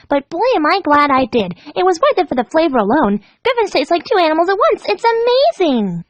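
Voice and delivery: high, chattering and giddy; gleeful Pinkie. Acted. Pinkie cheerfully telling Dash how she killed Gilda. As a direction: reminisce happily about a gruesome kill.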